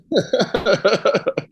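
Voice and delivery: big high burst of laughter at himself; loud self-mocking laugh. Comedian laughing at his own failure, loud cackle. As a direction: laugh it off loudly.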